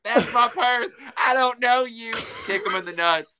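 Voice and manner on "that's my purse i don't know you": mock fight quote. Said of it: "I'm just gonna look at somebody like".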